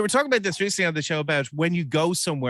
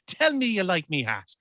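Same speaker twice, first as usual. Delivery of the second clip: higher, hammed-up stage Irish; stage Irish accent; 5 semitones higher than usual. Host mocking aunt's put-on brogue, stage Irish accent. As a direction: mimic a bad film-star Irish accent. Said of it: "she puts on an Irish accent".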